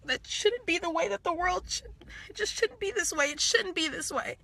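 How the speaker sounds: high tearful plea; crying. Real, not acted. Telling viewers it shouldn't be this way, crying. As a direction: plead through real tears, pitch lifted. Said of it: "I'm really sorry that I'm crying".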